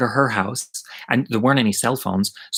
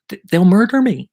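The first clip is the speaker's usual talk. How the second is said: high, loud acted-out panic; panicked pleading; 9 semitones higher, 6 dB louder than usual. Hiding kid fears the older boys, panicked. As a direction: wail it in retold desperation. Retelling panicked plea to friend.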